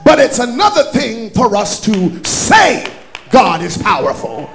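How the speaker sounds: loud, rising preacher's call over music; full-force preaching. Acted. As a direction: drive it home to the congregation. Preaching builds again to shouting.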